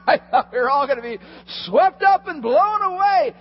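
high, loud, building storm tale; excited exaggeration. Real, not acted. Pastor telling the congregation about the storm, excited. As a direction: sell the storm like a thrill.

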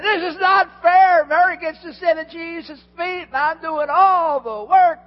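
high, loud, indignant outburst; angry Martha. Retold or imitated. Preacher voicing Martha protesting she does all the work, loud and indignant. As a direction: blurt out the unfairness, fed up. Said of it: "She said".

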